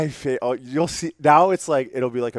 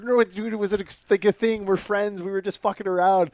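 frantic explaining; 10 semitones higher, 5 dB louder than usual. Retold or imitated.

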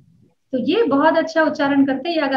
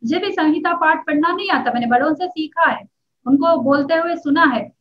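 normal talking voice

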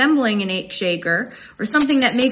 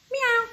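small, high, soft imitated meow; cat meow; 12 semitones higher, 4 dB quieter than usual. Sylvie the cat saying hello, soft high meow. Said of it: "says Sylvie".

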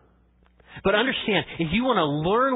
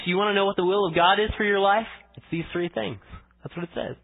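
ordinary delivery

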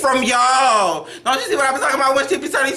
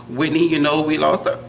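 ordinary delivery

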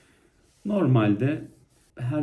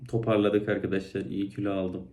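ordinary talk

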